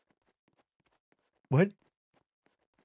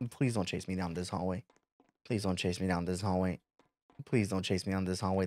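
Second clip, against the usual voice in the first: soft, low nervous plea; quiet pleading; 8 semitones lower, 11 dB quieter than usual. Real, not acted. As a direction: beg it quietly not to chase. Pleading to chasing animatronic.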